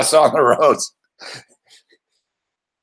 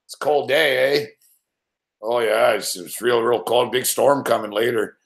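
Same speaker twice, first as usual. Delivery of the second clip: put-on Fargo Canadian accent; 5 semitones lower, 3 dB quieter than usual. Reactor mocks Canadians in a Fargo Canadian accent. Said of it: "a Canadian would fit right in in Fargo".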